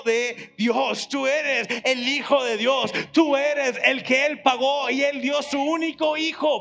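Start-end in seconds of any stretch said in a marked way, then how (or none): none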